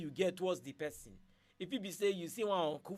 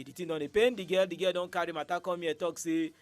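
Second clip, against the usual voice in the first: usual speaking voice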